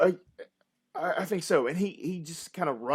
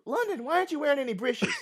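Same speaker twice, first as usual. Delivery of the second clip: much higher, fast nagging mother; mock mom scolding; 11 semitones higher, faster than usual. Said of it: "what my mom used to tell me".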